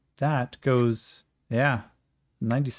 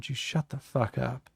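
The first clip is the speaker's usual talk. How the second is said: quiet snap; 5 dB quieter than usual. Acted. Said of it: "Yakim looks him up and down".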